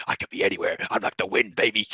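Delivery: hushed, whispery phone-killer voice; imitating taunting killer. Killer taunting Cindy by phone, imitated in a whisper.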